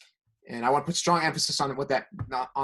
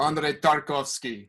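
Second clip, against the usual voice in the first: drawn-out put-on Russian accent; slower than usual. Film fan asked to say the director's name, Russian accent. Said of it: "Can you say it in a Russian accent?".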